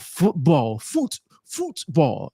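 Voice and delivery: a hint of mock British on football; badly faked British accent, drawn out; playful outburst in shaky British English. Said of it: "British accent".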